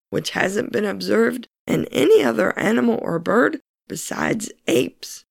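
ordinary talk

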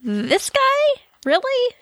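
high, disbelieving squeak; incredulous. Real, not acted. Incredulous repeat, big pitch jump.

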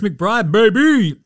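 loud, high hype call; hype shout. Real, not acted. Hyping a player's big game, loud call. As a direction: yell it out for your guy.

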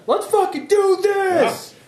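high, slow hype cry; pumped up. Acted. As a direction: fire yourself up for battle. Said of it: "I'm actually like".

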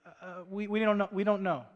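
stammered, uncertain dodge; hesitant evasion. Acted. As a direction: stumble over it like someone caught out. Acts priests dodging Jesus' question.

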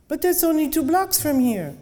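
surprised protest. Acted. Driver protests short fare.

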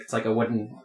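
ordinary talk, nothing marked

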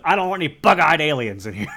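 cat voiced as a cranky TV producer, lightly done; Brian Cox as Sydney Newman. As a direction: bark it like a grumpy studio boss. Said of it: "Had to do my Sydney Newman, Brian Cox".

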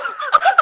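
very high, sudden laugh burst; high squeal of laughter. Real, not acted. Retelling her struggle on the toilet, squealing with laughter.

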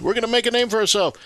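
high, quick mock proclamation; puffed-up imitation of a boastful crowd; mock boasting. Mocking the boast of Babel's builders.

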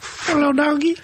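put-on baby talk to a dog. Cooing at dog.

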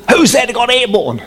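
mock the tower asking who took off; puzzled controller. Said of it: "and they said".

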